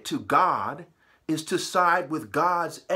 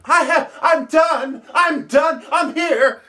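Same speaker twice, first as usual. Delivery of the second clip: higher, louder mocking yell; mock finished shout; 8 semitones higher, 7 dB louder, slower than usual. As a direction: shout it like a smug winner. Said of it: "he finished".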